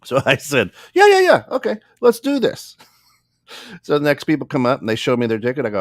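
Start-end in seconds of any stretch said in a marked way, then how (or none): 0.9-2.8 very high, loud burst easing off; mock eagerness. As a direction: overplay an eager go-ahead. Retold eager reply to the instructions.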